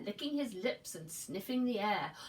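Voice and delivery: usual speaking voice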